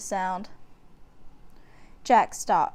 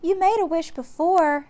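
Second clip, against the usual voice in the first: put-on much higher, louder girlish reminder; young girl remembering; little girl; 8 semitones higher, 5 dB louder than usual. A narrator's reading. Annie reminding Jack, high girlish voice. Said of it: "said Annie".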